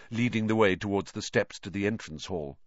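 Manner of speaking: ordinary delivery, nothing marked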